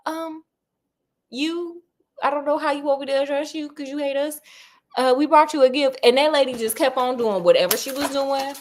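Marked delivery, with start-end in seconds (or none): 0.1-6.0 timid Kathy. Retold or imitated. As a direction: nervously address the cold lady. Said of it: "She was like".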